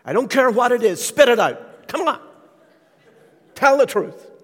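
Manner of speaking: an insistent mother demanding the truth; emphatic mother. Retold or imitated. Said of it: "she got a little more emphatic".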